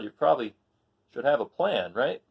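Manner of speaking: plain reading voice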